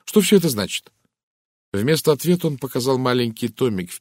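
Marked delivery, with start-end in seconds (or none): 0.0-0.9 higher, louder, insistent question; sharp challenge with raised pitch; rather sharp. A narrator's reading. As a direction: demand to know, sharply. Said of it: "довольно резко спросила Кристина".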